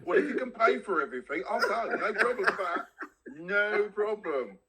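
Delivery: giggling banter, pitch up high; laughing. Real, not acted. Laughing at his co-host teasing him to leave, high and giggly. Laughing off being told to move.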